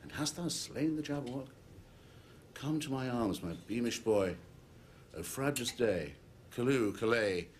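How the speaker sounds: high, wondering voice swelling into celebration; joyful father. Acted. Father welcomes his beamish boy, joyful exclaiming. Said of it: "he chortled in his joy".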